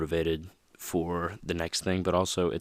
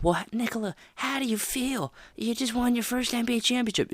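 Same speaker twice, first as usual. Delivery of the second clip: mock reporter voice, much higher; reporter's question. Courtside reporter asking the champion how he feels, much higher mock voice. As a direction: ask it in a bright, high put-on interviewer voice. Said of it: "He says".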